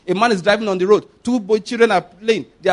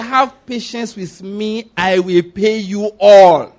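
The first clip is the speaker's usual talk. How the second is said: very slow quote, swelling loud on the end; drawn-out plea; 4 dB louder, much slower than usual. Retold or imitated. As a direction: stretch every word as the debtor's solemn promise. Quotes parable debtor pleading for time.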